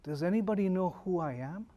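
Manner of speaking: low, very soft, understated sorrow; retold tearful plea. Lost prisoner of war asking the crowd, quietly tearful. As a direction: ask it quietly, as if close to tears. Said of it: "he's crying and he says".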